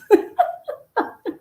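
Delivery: high breathy burst of laughter; real laugh at her own joke. Real, not acted. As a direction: let the laugh escape high. Laughs after punchline.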